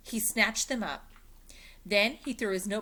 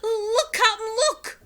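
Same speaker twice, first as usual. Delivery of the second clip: excited crewman; 11 dB louder than usual. Acted. Pinky excited.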